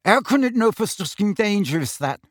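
talking through a full mouth; words round a mouthful of food. A narrator's reading. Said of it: "His mouth was so full".

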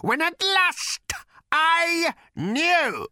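very high, slow, shrieking hatred. A narrator's reading. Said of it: "feverish pitch of hatred".

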